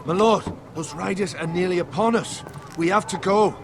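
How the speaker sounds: high-pitched, pressing alarm; urgent warning. Acted. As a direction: warn your lord to flee now. Warns riders are nearly upon them.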